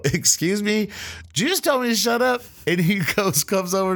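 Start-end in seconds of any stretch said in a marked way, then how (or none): 0.0-2.4 high, rising retold indignation; indignant disbelief. Cook challenging coworker who said shut up, indignant. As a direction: fire back pitched way up, disbelieving.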